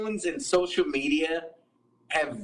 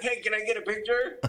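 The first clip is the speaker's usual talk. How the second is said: pushy fan; 6 semitones higher than usual. Retold or imitated. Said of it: "they'll be like".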